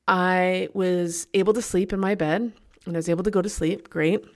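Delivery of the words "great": ordinary talk, nothing marked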